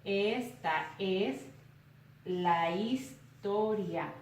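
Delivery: "Esta es la historia" very slow, split into drawn-out syllables with pauses. Shown as a demonstration. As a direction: break "Esta es la historia" into spoken syllables, "esta es" and "la his" run together. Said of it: "comienzo a separar en sílabas".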